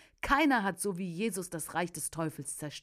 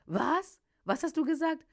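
higher, incredulous question; startled question; 10 semitones higher, 4 dB louder than usual. A narrator's reading. Assistant questions the preacher's unexpected order, startled. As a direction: blurt it out, pitched well up.

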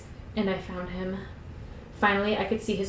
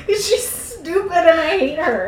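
high, slow, laughing complaint; laughing scorn; 9 semitones higher, 5 dB louder, slower than usual. Real, not acted. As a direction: drag it out through laughter. Mocking the heroine, laughing.

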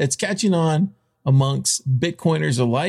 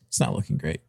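softer and lower, ominous; quiet grim repeat; 5 semitones lower, 7 dB quieter, faster than usual. Shown as a demonstration. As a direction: drop the voice, grim warning. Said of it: "Let's just say this".

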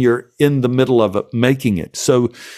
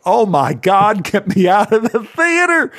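mock terror; 8 semitones higher than usual. Retelling terror at Alien screening.

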